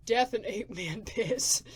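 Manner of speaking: slow words broken by a laugh. Acted. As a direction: laugh through the last word. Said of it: "He pats his stomach while laughing".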